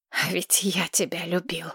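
bitter soft whisper; whispered reproach. Acted. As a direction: whisper it, wounded. Bloodied lover reproaches her. (горький шёпот)

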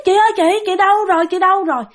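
high, repeated calls for big sister; insistent calling. Retold or imitated. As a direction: spirit boy keeps calling, pitch held high. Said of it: "kêu miết". (gọi chị dồn dập, giọng cao)

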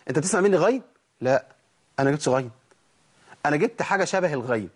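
ordinary delivery

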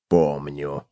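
low cockroach voice; interrupting cockroach. A narrator's reading. Cockroach interrupts the gopher, curt and low. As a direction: cut in with a deep, short answer. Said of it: "перебил таракан".

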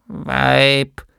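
mürrischer kleiner Gnom, etwas lauter; griesgrämig. A narrator's reading. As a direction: knurrt seine Frau grantig an. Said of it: "sagte das Männchen griesgrämig". (griesgrämiger Gnom, lauter)

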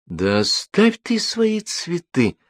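high, drawn-out irritated mother. A narrator's reading. Mother to father over his watering of flowers, irritated. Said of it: "раздражённо говорит мать отцу".

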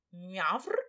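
cat's growly meow at modest volume; growling meow. A narrator's reading. Cat backs up his mistress against brawling maids, growling meow. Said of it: "оглушительно обрычал всех".

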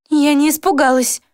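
high, indignant protest; a young woman's offended retort; indignant girl. A narrator's reading. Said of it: "возмутилась она искренне".

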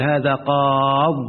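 هذا قاض said very slowly, stopping dead on the ض; slow teaching read of قاض; pausal form demonstrated. Shown as a demonstration. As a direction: drag out قاض like a model recitation. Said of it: "يقول".

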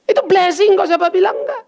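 high, laughing through the words. Real, not acted. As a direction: laugh it out, amused. Laughing while preaching. (tertawa sambil berkata)

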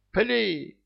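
high tipsy giggling command; giggly drunk. A narrator's reading. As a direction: bark the order like a merry drunk. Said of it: "командовал себе лихо, пьяно хихикая".

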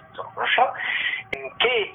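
usual speaking voice

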